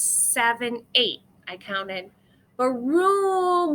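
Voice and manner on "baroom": loud, drawn-out thunder noise; booming thunder. A narrator's reading. Narrator booms the thunder for the listening child. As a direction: boom it out like a storm.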